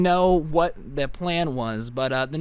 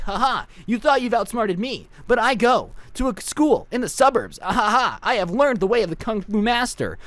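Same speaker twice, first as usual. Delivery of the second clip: high hammed-up anime hero; mock a dramatic anime fighter; 5 semitones higher than usual. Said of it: "I started doing the anime voice".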